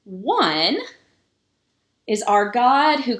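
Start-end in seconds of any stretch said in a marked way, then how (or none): none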